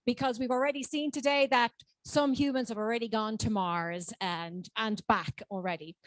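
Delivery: ordinary delivery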